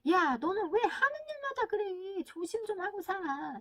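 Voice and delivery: high, drawn-out exasperated telling-off; acted nagging, loud and slow at first; scolding parent. Parent berates child over spilt milk, mock scolding. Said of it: "이렇게 말을 하지 마시고".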